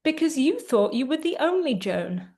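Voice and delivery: normal talking voice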